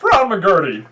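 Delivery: himbo voice. Acted. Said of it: "Will's himbo voice".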